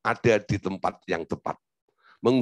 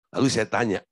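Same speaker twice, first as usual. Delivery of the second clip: normal talking voice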